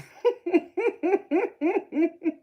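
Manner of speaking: shrieking laugh, loud and high; real laughing fit; loud high cackle. Real, not acted. Laughing at a chat comment.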